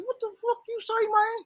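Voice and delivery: very high, thin mock outrage; imitated hot temper. Friend confronting cab driver, mock angry in high voice. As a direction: challenge him in a soft, high-pitched voice. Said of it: "O gets hot".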